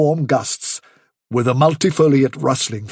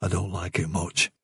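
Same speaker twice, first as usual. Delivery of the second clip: low, much softer confidential remark; quiet aside; 7 semitones lower, 10 dB quieter than usual. Acted. Silver confiding unease to Hazel, quiet. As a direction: drop it right down, for one listener only. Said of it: "he added quietly to Hazel".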